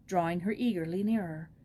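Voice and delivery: ordinary delivery, nothing marked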